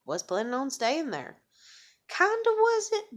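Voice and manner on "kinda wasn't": squeaky aside. Real, not acted. Self-correction squeak.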